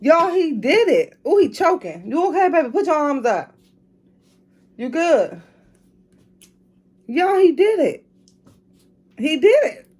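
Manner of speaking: high, beaming praise for her son; celebrate, thrilled and proud; excited. Real, not acted. Son finishes drink, mom celebrates.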